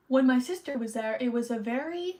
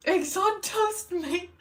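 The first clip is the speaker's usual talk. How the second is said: high, drawn-out British impression; hammed-up British greeting, pitched way up; mock British accent; 7 semitones higher, slower than usual. Streamer teasing a British viewer, mock British accent. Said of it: "oh, you're British?".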